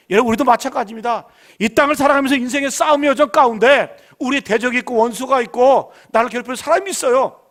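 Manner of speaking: loud rising sermon cry, leaning on each phrase; rally the flock, voice pushed out and ringing; full-force preaching. Acted. Pastor rousing the congregation about life's enemies, loud preaching.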